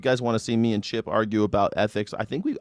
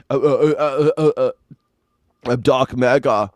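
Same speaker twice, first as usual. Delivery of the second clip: louder, stammering mock Elon; Elon Musk impression; 5 dB louder than usual. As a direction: stutter out the name. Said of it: "He always does that".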